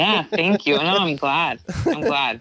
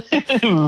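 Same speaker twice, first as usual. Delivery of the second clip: loud, high laugh; laughter; 9 semitones higher, 8 dB louder than usual. Real, not acted. Guest saying goodbye to the host, laughing. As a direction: burst into real, high laughter.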